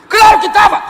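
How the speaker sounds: very loud, high shout; emphatic shout. Real, not acted. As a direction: shout it out to the congregation. (grito enfático bem alto)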